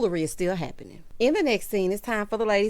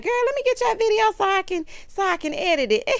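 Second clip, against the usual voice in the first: mocking Heavenly; 12 semitones higher than usual. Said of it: "She was like".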